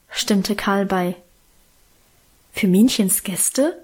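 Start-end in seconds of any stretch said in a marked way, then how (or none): 2.5-3.8 astonished. A narrator's reading. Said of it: "fragte ich erstaunt".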